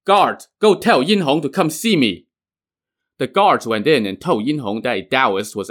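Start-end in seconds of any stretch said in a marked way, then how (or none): 0.0-2.3 calling out loudly, pitched up; shouted order. A narrator's reading. Taoist calling to the Shang camp guards, shouting. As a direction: shout it across to the enemy camp.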